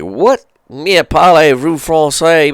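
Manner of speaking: high, loud, slow baffled question; baffled what. Retold or imitated. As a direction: act out your total confusion at the French. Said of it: "and I was like".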